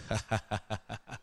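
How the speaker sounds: low, quiet chuckle; long chuckle in even bursts; laugh. Real, not acted. Laughs at own story.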